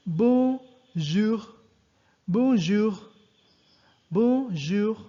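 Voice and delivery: "bonjour" drawn out slowly with a rolled throat R; teacher models "bonjour" slowly, word by word; slow French bonjour. Shown as a demonstration. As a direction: say "bonjour" slowly for students to copy. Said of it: "slowly this time".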